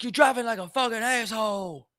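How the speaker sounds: mock aggressive stranger; retold road-rage accusation; imitating angry guy. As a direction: put on the shouting guy's voice. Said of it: "He's like".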